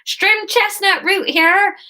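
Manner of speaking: high, slow Somerset market seller; Somerset accent. Acted. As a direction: sing it out in a broad Somerset accent. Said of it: "this is gonna be Somerset".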